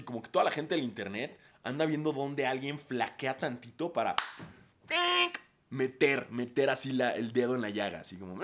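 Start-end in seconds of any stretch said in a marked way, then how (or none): 4.9-5.4 high sharp mouth tink; playful sound effect; pointed tink sound. Pretend. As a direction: pop out a little tink.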